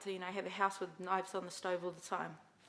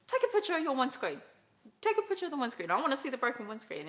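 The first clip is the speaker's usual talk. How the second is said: loud, higher insistent demand; loud demanding older sister; firm demand; 5 semitones higher, 8 dB louder, faster than usual. Retold or imitated. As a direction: insist loudly on proof. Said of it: "And I was like".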